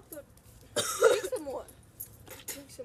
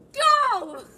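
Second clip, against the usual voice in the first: shouted go; 5 semitones higher, 8 dB louder than usual. Real, not acted. Countdown to drinking.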